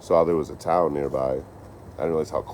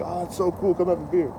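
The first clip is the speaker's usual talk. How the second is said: imitated drunk locals; 14 semitones higher than usual. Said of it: "they're like".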